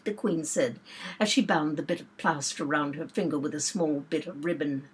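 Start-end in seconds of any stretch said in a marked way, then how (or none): none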